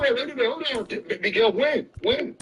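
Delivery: high-pitched, urgent demand; insistent interruption. Real, not acted. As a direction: cut in and press for an answer. Cuts in, demanding proof.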